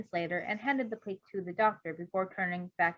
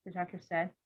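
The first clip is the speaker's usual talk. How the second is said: ordinary delivery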